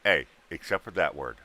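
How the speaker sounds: ordinary talk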